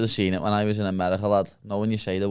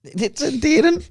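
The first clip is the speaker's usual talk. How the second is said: much higher, laughing as he repeats it; cracking up with disbelief; 4 dB louder, slower than usual. Real, not acted. Mocking friend's claim, laughing.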